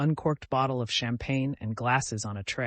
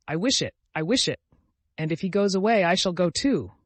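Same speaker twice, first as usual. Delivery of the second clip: defiant, eyes flashing; 6 semitones higher, 7 dB louder than usual. A narrator's reading. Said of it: "she added with flashing eyes".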